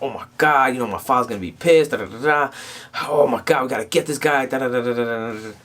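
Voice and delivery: mildly acted angry venting; retold anger. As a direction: imitate an upset rant, trailing into da-da filler. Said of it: "she was angry".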